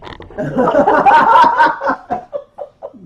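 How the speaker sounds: long, high burst of laughter; big laugh. Real, not acted. Storyteller laughs at his own embarrassing story, high laughter.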